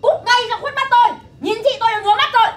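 very high, loud screaming order to get out; furious yelling. Acted. Mother-in-law screaming at the daughter-in-law to get out. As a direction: throw her out at full rage.

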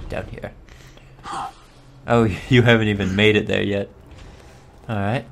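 ordinary talk, nothing marked

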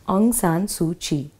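normal talking voice

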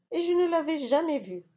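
usual speaking voice

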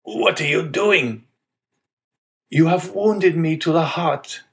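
lightly voiced old father; a hint of a frail old man.

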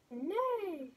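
loud, high neighing call, the goose faking a horse; mock horse neigh. A narrator's reading. Goose fooling the thieves, loud mock neigh. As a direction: belt out a silly neigh. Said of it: "with all her might".

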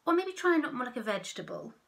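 quick, bright sea cucumber; helpful suggestion. Acted. Sea cucumber offering advice, quick and light. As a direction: rattle it off helpfully. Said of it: "suggested the sea cucumber".

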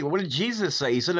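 normal talking voice

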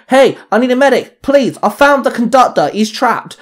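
high, loud urgent shout; barking for a medic. A narrator's reading. Narrator shouting for medics after the train. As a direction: bark for help. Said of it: "I barked".

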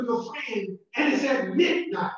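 normal talking voice

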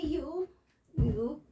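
ordinary talk, nothing marked

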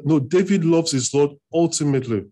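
ordinary delivery

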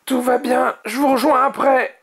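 high-pitched shout to teammates; high young dragon yell; calling out. A narrator's reading. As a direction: shout reassurance after them. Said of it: "cria-t-il".

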